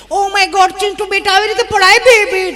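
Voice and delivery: high, loud mock-horrified fussing; shrieky over-concern for a fallen baby; fussing modern mother. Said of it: "mummy બિચારી આપણી ફાફડી". (ગભરાયેલી મમ્મીની ઊંચી નકલ)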